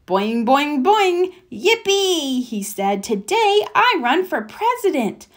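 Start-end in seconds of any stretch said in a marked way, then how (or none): 0.1-2.4 high, springy boings; bouncy excitement. Acted. Froggy bouncing on his bed, cheering high. 3.0-5.3 high, springy boings; bouncy excitement. Acted. Froggy bouncing on his bed, cheering high.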